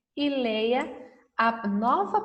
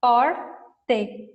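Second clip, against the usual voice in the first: slow and clear; 4 dB louder, much slower than usual. Shown as a demonstration. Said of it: "Se eu colocar o R".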